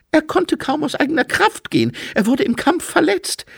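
very high, loud, hurried lady; Lady Lejeune. Acted. Lady Lejeune explaining the injured man's state, high, loud and hurried.

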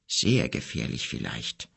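put-on an old wizard warning the boy, low and grave; ominous wizard warning. A narrator's reading. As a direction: let it sink in darkly. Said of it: "wizard warns darkly".

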